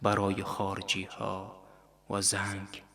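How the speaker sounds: quiet, faintly scornful aside; mocking murmur. A narrator's reading. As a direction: murmur it to yourself with understated mockery. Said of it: "آهسته زیر لب گفت".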